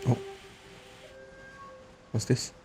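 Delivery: normal talking voice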